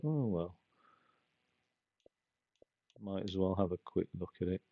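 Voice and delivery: dropped right down, half to himself; quiet aside. Real, not acted. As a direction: mumble it under your breath.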